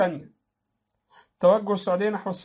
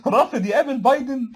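cracking up; 4 semitones higher than usual. Real, not acted. Host scoffing at a ruler refusing to meet Biden, laughing hard. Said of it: "أقعد أضحك قوي".

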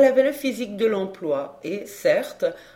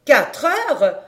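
higher, louder shocked exclamation; startled cry; 6 semitones higher, 7 dB louder than usual. Acted. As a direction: jolt up as if the time just hit her. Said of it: "s'écria la sorcière".

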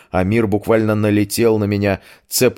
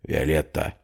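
softly hissed name, close to the ear; quiet hiss; 3 semitones lower, 6 dB quieter than usual. A narrator's reading. Amir hisses at the girl's ear in the dark, quiet hiss. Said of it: "тихий голос Амира прошипел на ухо".

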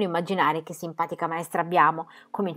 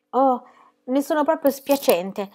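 higher, slow, apologetic; contrite; 7 semitones higher, slower than usual. A narrator's reading. Pippi apologises to the teacher, contrite. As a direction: sound sorry, perhaps a bit hammed-up. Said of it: "disse Pippi tutta contrita".

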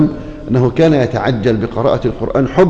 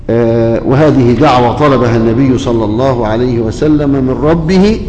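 usual speaking voice

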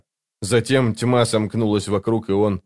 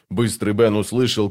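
usual speaking voice

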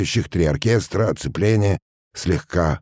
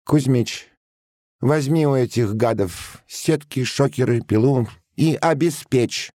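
high-pitched officer's command; ordering officer; 9 semitones higher than usual. A narrator's reading. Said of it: "приказал подпоручик". (приказывает высоким голосом)

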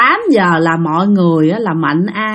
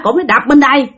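ordinary delivery

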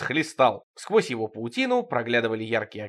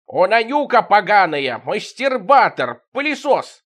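loud, higher, slow shrieking; offended shouting; 7 semitones higher, 7 dB louder, slower than usual. Acted. Offended Evlampiya shouting at Fima, loud and high. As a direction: hurl the insults. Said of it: "Громче всех кричала обиженная Евлампия".

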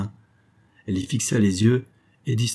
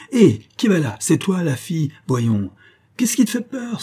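high, imperious challenge to a servant; sharp calling out; 8 semitones higher, 4 dB louder than usual. Acted. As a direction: hail the maid impatiently. Master calling sharply to the maid.

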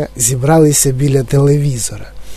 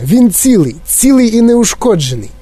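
high, triumphant exclamation; delighted; 8 semitones higher than usual. A narrator's reading. Wonka declaring the boy unharmed, delighted. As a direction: announce good news with raised pitch. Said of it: "зрадів містер Вонка".

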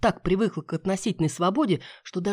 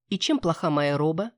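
ordinary delivery, nothing marked

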